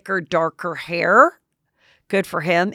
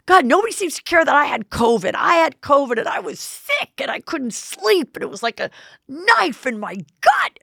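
whiny mock husband; 8 semitones higher than usual. Mocking Cody's covid whining.

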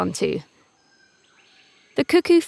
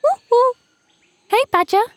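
much higher, chirpy bird voice; bright little bird calling out; eager cuckoo voice; 5 dB louder than usual. A narrator's reading. Cuckoo calls out.

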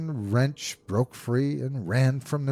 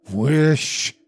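loud, higher-pitched command; strong cry; 5 semitones higher, 6 dB louder than usual. A narrator's reading. Wife orders her husband to make the wish, strong cry. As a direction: cry it out forcefully. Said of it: "cried in a strong voice".

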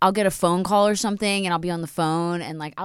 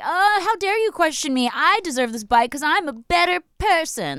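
entitled person voice; 8 semitones higher than usual. Retold or imitated.

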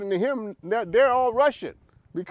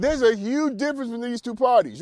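ordinary talk

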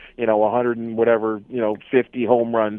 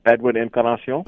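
rolled Spanish name; 3 semitones lower than usual. Shown as a demonstration. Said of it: "you got the good tongue roll going".

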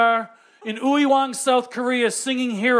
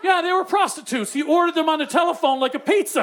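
ordinary talk

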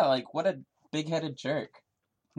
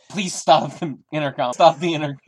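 louder, desperate pleading shout; 4 semitones higher, 10 dB louder than usual. Acted. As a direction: yell at the intercom to stop. Begging intercom to stop.

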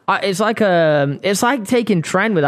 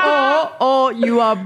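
laughing; 7 semitones higher, slower than usual. Real, not acted. Laughing while announcing.

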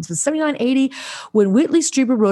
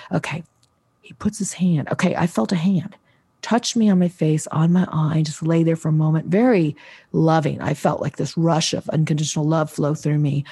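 normal talking voice

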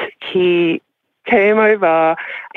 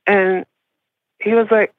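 ordinary talk

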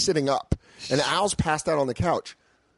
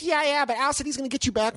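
put-on girly voice; 11 semitones higher than usual. Imitating the blonde girl.

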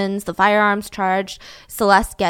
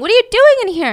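very high, loud mock scream; retold screaming; 12 semitones higher, 6 dB louder, faster than usual. Friend confronting the man above the bed, screaming. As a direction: act out a shrieked demand. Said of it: "She's screaming".

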